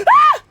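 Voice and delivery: loud, high yelp after a gasp; gasp, then cry out sharply; sudden scream. Real, not acted. Player taunting an enemy, cut off by a loud scream.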